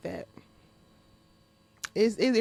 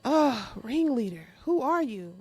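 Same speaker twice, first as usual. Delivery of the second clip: usual speaking voice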